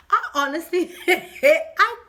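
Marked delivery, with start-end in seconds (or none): 1.0-2.0 laughing through words. Real, not acted. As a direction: giggle as you speak. Laughing heard.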